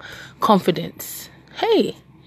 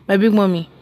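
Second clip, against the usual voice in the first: normal talking voice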